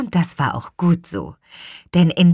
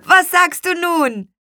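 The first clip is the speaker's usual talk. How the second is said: high, loud gloating question; triumphant call; 12 semitones higher, 5 dB louder than usual. A narrator's reading. Boy shows Lea the flying kite, loud triumphant call. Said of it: "rief Luis".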